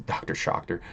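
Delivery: soft, unvoiced repeat of the name; whispered aside. Real, not acted. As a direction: whisper the name back under your breath. Repeating the username to himself.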